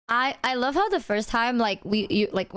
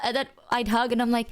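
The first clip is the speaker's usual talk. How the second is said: ordinary delivery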